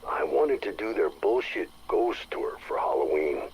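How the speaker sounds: usual speaking voice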